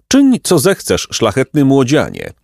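lightly voiced grand bird, high pitch; high, ceremonious pelican; courtly pelican. A narrator's reading. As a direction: grant permission with lofty courtesy. Story figure, pelican.

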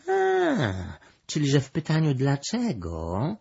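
high, mewing cat; meowing cat voice. A narrator's reading. The cat works out the question "why", meowing. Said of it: "miałknął kot makawity".

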